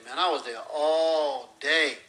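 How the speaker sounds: "all day" drawn out long and slow; weary complaint with a long, loud "all day"; stretched for emphasis. Acted. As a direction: stretch "all day" to show how endless it felt. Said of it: "You stretch out all day for emphasis".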